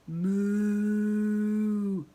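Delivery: long, loud drawn-out moo; cow moo. Acted. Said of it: "The cow says".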